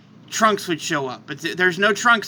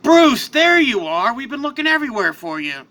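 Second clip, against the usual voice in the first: Superman relieved; 5 semitones higher, 4 dB louder than usual. Acted. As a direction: call out to a lost friend, relieved. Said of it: "Superman walks out of the portal and says".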